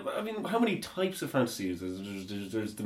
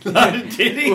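higher, louder, through real laughter; laughing; 7 semitones higher, 9 dB louder than usual. Real, not acted. Echoing a friend's crude remark, laughing. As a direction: crack up while repeating it. Cracks up repeating friend's phrase.

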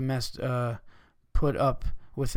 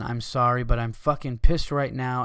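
usual speaking voice

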